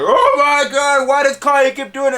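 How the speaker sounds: high, loud imitation of dismayed fans; mock fan outcry. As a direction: wail it like an exasperated fan, hammed-up. Imitating dismayed fans' reaction.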